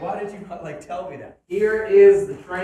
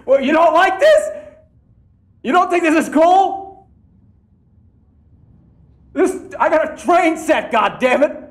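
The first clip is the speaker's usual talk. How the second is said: loud, high, incredulous outburst; worked-up, high-pitched protest; indignant shouting; 8 semitones higher, 8 dB louder than usual. Real, not acted. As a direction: shout it defensively at doubters. Rants at unimpressed viewers.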